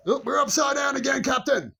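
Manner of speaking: high, excited call to the captain; playful call. Pretend. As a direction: call out to the captain in mock alarm. Calling out to the captain.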